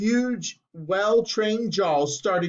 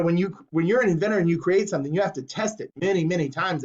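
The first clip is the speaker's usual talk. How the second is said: usual speaking voice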